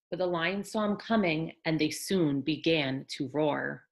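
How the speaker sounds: put-on gentle read-aloud for children; storybook narration for little ones; bedtime story reading. A narrator's reading. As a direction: tell it like a picture book at bedtime.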